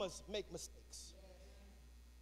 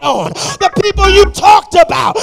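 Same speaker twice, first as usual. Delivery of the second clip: building from firm exhortation to full shout; preaching to a climax; 6 semitones higher, 28 dB louder than usual. Real, not acted.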